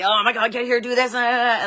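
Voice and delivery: loud, high, urgent imitation; worked-up mock urgency; intense dispatcher. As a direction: bark out frantic orders as the dispatcher. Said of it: "he was very, like, intense".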